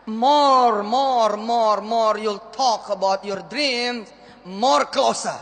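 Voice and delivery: mock English motivator.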